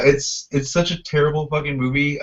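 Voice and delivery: normal talking voice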